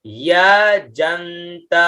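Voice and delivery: अयजंत drawn out slowly with half न; slow demo. Shown as a demonstration. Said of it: "अयज आधा न और त". (अयजंत धीरे, आधे न के साथ)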